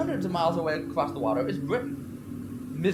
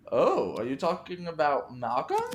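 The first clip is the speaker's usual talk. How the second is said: ordinary talk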